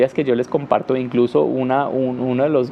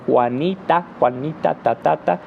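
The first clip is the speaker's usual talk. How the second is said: Juanita chopped into even separate syllables; choppy Spanish-style Juanita. Shown as a demonstration. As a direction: tap out Juanita like ta ta ta. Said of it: "en español estamos diciendo".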